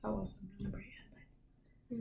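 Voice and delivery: very soft whisper. Real, not acted. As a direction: barely whisper the words. Late night whispering.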